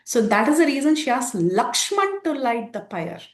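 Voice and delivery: usual speaking voice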